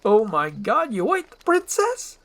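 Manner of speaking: loud, aghast cry leaping high; appalled high-pitched outburst; horrified. Acted. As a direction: gasp out a shocking accusation. Donkey horrified she ate princess.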